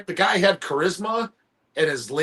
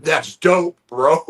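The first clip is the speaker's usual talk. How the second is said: mock bro slang; 4 dB louder, much slower than usual. Shown as a demonstration. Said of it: "you're making fun of it".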